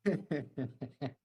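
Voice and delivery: quiet run of worried chuckles; uneasy laugh. Real, not acted. Editor laughs despite worry over the job, uneasy laugh. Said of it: "eu tô rindo, mas eu tô preocupado".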